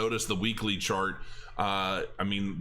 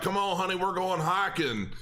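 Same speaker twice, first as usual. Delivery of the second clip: put-on country accent; 9 semitones higher than usual. Husband to wife on the backpack video, mock country accent. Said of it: "I threw on a country accent".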